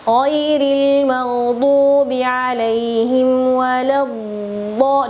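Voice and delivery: "waladdo" slow, the dhad held long; held dhad recitation. Shown as a demonstration. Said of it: "ditahan dulu". ("waladdo" dibaca pelan, dhad ditahan)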